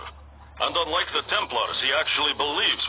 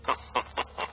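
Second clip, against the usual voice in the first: dry chuckle; 6 dB quieter than usual. Acted. Cynical remark about dead man.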